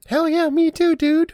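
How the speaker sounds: high, loud hell yeah; pumped-up teen shout; excited. Acted. Quinn's excited agreement.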